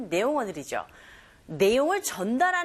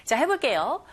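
usual speaking voice

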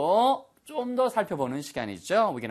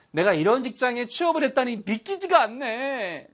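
delighted disbelief; 10 semitones higher than usual. Shown as a demonstration. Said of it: "이거 영어로 뭐라 할까요".

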